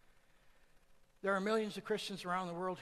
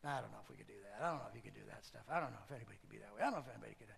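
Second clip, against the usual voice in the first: lower, softer and fast, mocking a doubter; mocking naysayer; 8 semitones lower, 6 dB quieter, much faster than usual. Preacher mocking a doubter, low and quick. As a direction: mimic a mumbling naysayer. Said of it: "for any of us to ever say".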